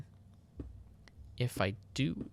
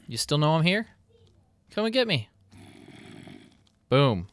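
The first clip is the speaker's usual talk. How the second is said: higher, louder teasing; playful taunt; 6 semitones higher, 5 dB louder than usual. Pretend. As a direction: goad the zombies into the trap. Taunting zombies.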